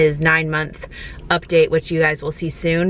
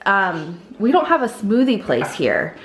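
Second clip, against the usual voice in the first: ordinary delivery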